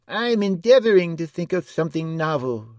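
high, loud dwarf's voice; bewildered little jester, pitched high; abstracted dwarf. A narrator's reading. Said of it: "replied the dwarf abstractly".

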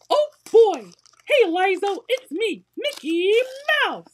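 high, loud cartoon mouse voice greeting a baby; Mickey Mouse impression. Greeting her baby Lizo, high and loud in a Mickey voice. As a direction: squeak it up, cheery and bright like a cartoon mouse. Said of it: "I used to be like".

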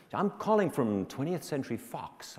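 high-pitched American lady caller; American accent. Retold or imitated. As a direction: announce it as an American woman on the phone. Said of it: "lady with an American accent".

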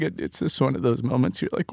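ordinary talk

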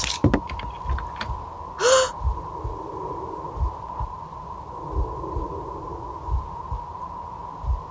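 long high frightened gasps; scared panting in hiding; panicked breathing. Real, not acted. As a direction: breathe in terror while hiding. Hiding from monster.

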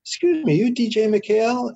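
high-pitched questioning stranger; approaching stranger. Retold or imitated. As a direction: imitate a stranger shyly checking who you are. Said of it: "somebody's gonna come up to you and say".

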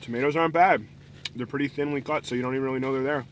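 chewing between words; eating while talking; mouth full. Real, not acted. Seen 2: Bit taco at -3; jaw working, cheeks full while speaking.

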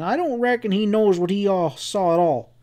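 high-pitched, scornful drawling cowboy; disgusted. A narrator's reading. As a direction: discredit him in a high voice. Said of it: "Sam said disgustedly".

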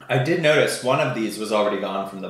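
chewing between words; eating ice cream bar; mouth partly full. Real, not acted.

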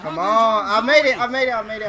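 excited. Real, not acted. Reaching safety alone.

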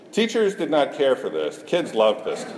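ordinary talk

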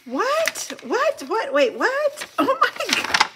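high-pitched, astonished repeated questioning; stunned disbelief. Real, not acted. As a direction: gasp in real, breathless surprise. Stunned on opening friend's surprise gift.

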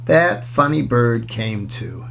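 plain reading voice

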